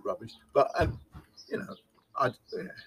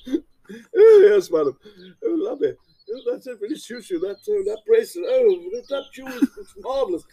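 put-on much higher, gushing posh English accent; posh salesman; 8 semitones higher, 6 dB louder than usual. Trapped salesman gushing to a lady shopper in posh accent. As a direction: fawn over madam in a plummy posh voice. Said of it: "stuck with doing this posh persona".

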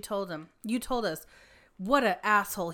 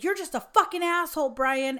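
louder, higher mock yell; retold angry yell; 5 semitones higher, 6 dB louder than usual. Doctor insulting his driver on the phone, imitated angry yell. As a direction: hurl it like the furious boss. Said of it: "he was yelling at Brian".

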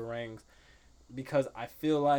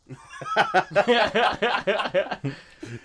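big burst of laughter; loud laugh; 6 semitones higher, 9 dB louder than usual. Real, not acted.

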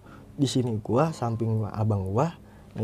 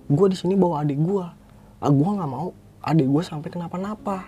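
raised, emphatic protest; retold quarrel; 5 semitones higher than usual. Brother protecting his younger sibling argues heatedly.

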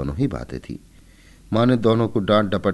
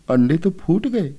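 higher, a touch of sorrow; pitiful voice; 10 semitones higher, 4 dB louder than usual. A narrator's reading. Keshav tells his sister the eggs broke, mildly pitiful. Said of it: "Keshav ने करुण स्वर में कहा".

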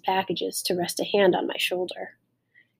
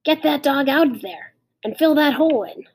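higher-pitched, insistent called order; mother calling out; 6 semitones higher, 4 dB louder than usual. A narrator's reading. Mother orders child to fill the hole, calling out. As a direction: call out firm orders from the window. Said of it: "She called".

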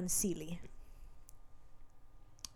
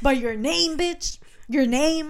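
raised mock yell, stretched at the end; mock an outraged cry, drawing it out; retold screaming; 5 semitones higher, 4 dB louder, slower than usual. Betrayed man throws her name at her, mild mock scream.

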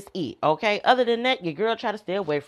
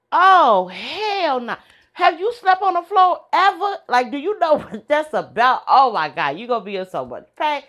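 loud, higher disbelieving outburst; shocked friend; 8 semitones higher, 6 dB louder than usual. Retold or imitated. Imitating a friend horrified about sleeping on the floor, loud.

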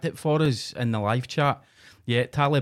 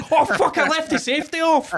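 high, loud panicked yell; hammed-up cry of sudden alarm; mock panic; 8 dB louder than usual. Taliban soldier on bumper cars realising his mistake, mock panic. Acts out soldier's accidental gunfire.

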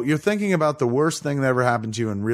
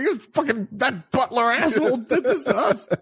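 high, laughing rant; much higher mock outrage; mock Bruce rant; 13 semitones higher than usual. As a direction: blame the butler in a hammed-up squeal. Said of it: "He's like".

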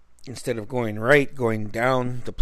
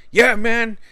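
high, louder imitation of Ira piping up; eager tattling; 9 semitones higher, 4 dB louder than usual. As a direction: chime in keenly against the driver. Ira eagerly tells the cop.

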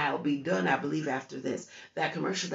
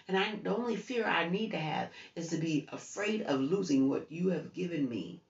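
normal talking voice

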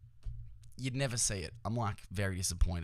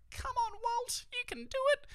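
very high falsetto cheer; root for the underdog in a tiny falsetto; retold hopeful plea, pitched sky-high; squeaky pleading encouragement.

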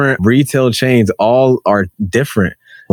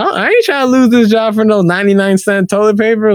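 ordinary delivery

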